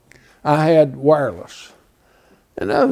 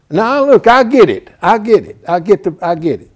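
louder, rising exasperation; heated, indignant burst; heated outburst; 5 semitones higher, 7 dB louder than usual. Retold or imitated. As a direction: push back hard, voice climbing. Losing temper on phone.

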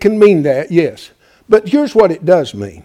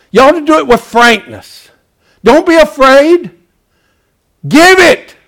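climbing higher and louder to a cry; rising exhortation; 6 semitones higher, 4 dB louder than usual. Acted. Preacher urging the church to be bold, building to a shout.

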